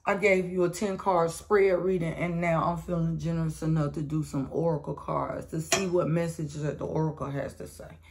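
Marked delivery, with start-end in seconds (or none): none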